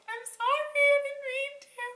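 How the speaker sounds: very high, loud mock squeal; hammed-up high-pitched apology; squeaky apology. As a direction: plead sorry in a tiny squeaky voice. Mock squeaky apology to frightened friend.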